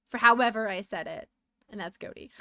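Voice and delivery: ordinary delivery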